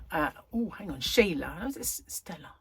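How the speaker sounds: ordinary delivery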